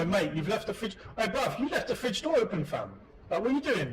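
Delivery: playful roadman telling you off about the fridge; London roadman slang. Pretend. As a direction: hype it up like a London youth. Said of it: "I would probably say something like".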